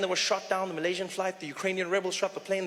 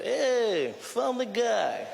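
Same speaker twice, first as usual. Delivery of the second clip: higher, slow mock Russian heckler; threatening Russian accent; 4 semitones higher, much slower than usual. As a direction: tease the comedian in a thick Russian accent. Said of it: "Walking out of the theater".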